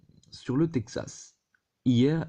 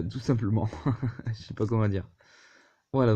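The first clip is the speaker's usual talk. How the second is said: ordinary talk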